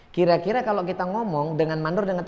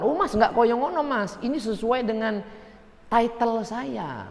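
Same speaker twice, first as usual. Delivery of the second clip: boastful know-it-all; 3 semitones higher, 3 dB quieter than usual. Retold or imitated. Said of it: "Nah, ngomong kayak ini".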